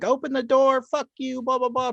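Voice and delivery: high, shrill-pitched mock yell of angry women; mock screaming. Neighbors' visitors banging on the door, mock yelling. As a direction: screech it like an angry date at the door. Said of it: "screaming and yelling".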